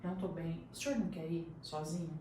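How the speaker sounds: barely holding it together; weak and unwell. Retold or imitated. Daughter begging father to go without her, faint and tired.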